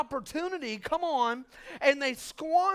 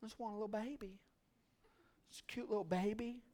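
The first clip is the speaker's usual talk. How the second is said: wistful parent; 2 semitones lower, 12 dB quieter, faster than usual. Retold or imitated. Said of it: "all you wanted was a child".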